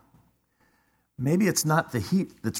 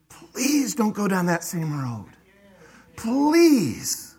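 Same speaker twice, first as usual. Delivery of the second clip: pleading; 5 semitones higher, slower than usual. Acted. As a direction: beg like a worried parent, stretching the final please. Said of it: "we over-communicate 'cause we're so".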